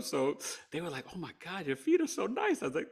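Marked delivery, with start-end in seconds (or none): 1.1-2.6 imitated gushing. Nurses gushing over feet.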